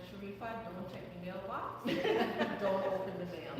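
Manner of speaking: lightly voiced Trinidadian dad, laughing through it; mock Trinidadian dad giving bad advice with a laugh; Trinidadian accent. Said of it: "in his Trinidadian accent".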